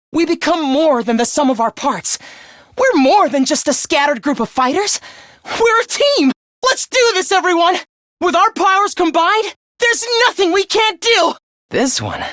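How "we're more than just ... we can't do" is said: high-pitched, impassioned team cheer; rousing rally. Acted. Fighter urging the team on with powers combined, rousing. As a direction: build to a high, ringing battle call.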